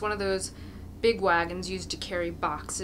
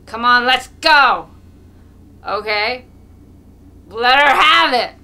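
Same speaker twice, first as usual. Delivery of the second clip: put-on drunken shout; 3 semitones higher, 14 dB louder than usual. A narrator's reading. Mokolka rallies the crowd.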